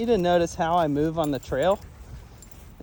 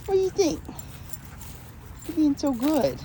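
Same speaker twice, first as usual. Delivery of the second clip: higher, sing-song; higher cooing praise on the walk; cooing at the dog; 11 semitones higher than usual. Real, not acted. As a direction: sweet-talk the dog. Talking to dog.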